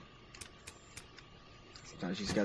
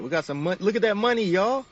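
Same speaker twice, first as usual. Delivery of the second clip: loud, much higher thrilled shout; excited about money; 12 semitones higher, 9 dB louder than usual. Real, not acted. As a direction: crow over the cash.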